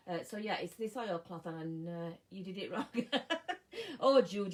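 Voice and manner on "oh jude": laughing out loud at the name. Real, not acted. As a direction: burst out laughing fondly. Laughing at viewer's comment.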